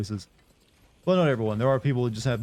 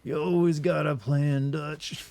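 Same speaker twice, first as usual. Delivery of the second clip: slow, higher cowboy outlaw drawl; Arthur Morgan impression; 4 semitones higher, slower than usual. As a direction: lean into the weary gunslinger voice, drawn out. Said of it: "your best Arthur Morgan impression".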